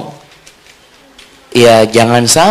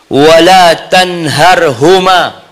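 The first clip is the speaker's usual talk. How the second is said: slow, raised, measured recitation; Quran recitation; 5 semitones higher, much slower than usual. Real, not acted. Preacher quotes Allah's command on parents, slow Quran recitation. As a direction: recite with long, stretched syllables.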